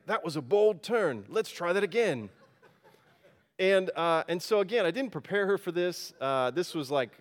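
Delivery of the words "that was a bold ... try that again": lightly imitated Aussie sat-nav, pitched up; a touch of mock Aussie; Australian accent. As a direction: do a louder, higher Australian GPS voice. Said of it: "I'll do my best Australian accent".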